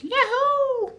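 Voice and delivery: high, whooping yahoo. Retold or imitated. Han Solo cheering as he blasts Vader away, high whoop. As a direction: whoop it like a cowboy. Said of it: "Han Solo goes".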